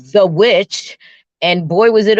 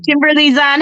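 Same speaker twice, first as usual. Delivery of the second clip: ordinary talk, nothing marked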